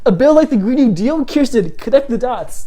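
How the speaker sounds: loud, high-pitched exasperated outburst; riled up. Real, not acted. Vlogger addressing the senator about the Green New Deal, riled up. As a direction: raise your voice, exasperated, as if scolding her. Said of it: "this riles me up".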